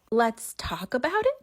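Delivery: slow, rising disbelief on it; "Let's talk about it?" spelled out slowly, voice climbing in outrage; incredulous echo. Real, not acted. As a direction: repeat his words like you can't believe them. Echoing his words in disbelief.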